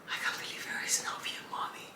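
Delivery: hushed whisper. Real, not acted. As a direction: breathe it tenderly, no voice. Talking to baby over clothes.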